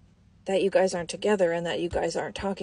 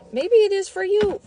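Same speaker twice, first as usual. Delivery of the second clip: sarcastic jab; 12 semitones higher, 6 dB louder than usual. Acted. Sarcastic jab at the person's claim.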